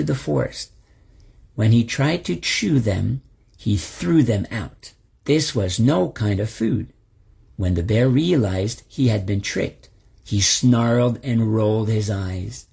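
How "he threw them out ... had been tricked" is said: usual speaking voice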